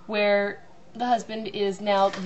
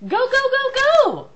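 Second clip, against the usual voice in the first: much higher and louder frantic yelling; shouting directions; 9 dB louder, slower than usual. Retold or imitated. Husband guiding wife backing the car, shouting. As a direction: bark orders at the driver in a panic. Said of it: "he's shouting".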